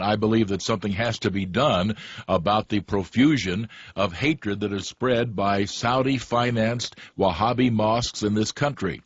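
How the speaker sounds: usual speaking voice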